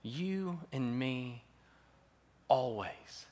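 loud, slow swell then hushed; voicing Jesus' love. Preacher voicing Jesus to the congregation, loud then tender. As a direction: proclaim it big, then soften to a promise. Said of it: "Jesus comes and he says to you".

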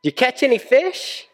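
high-pitched call across the water; acted hail from the shore, pitched well up; yelling out. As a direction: call out to men far off in a boat. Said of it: "the stranger yells out".

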